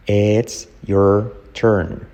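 slow, separate words: IT'S, YOUR, TURN; slow model take. Shown as a demonstration. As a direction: leave gaps between "It's", "your" and "turn". Teacher models words one by one.

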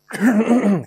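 repeated rough throat clear; involuntary clearing of the throat; real throat clearing. Real, not acted. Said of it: "No he hecho queriendo". (carraspeo sin querer)

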